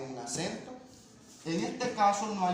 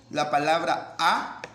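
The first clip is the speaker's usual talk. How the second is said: A drawn out and louder; slowed, louder A on the board; stressed A; 7 dB louder, slower than usual. Shown as a demonstration. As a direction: hold the A for the class. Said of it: "con H es una exclamación".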